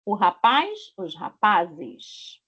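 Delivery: "os rapazes" very slow and drawn out; teacher showing the ES ending; slow plural demo. Shown as a demonstration. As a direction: stretch "os rapazes" slowly. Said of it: "Recebe E S no final".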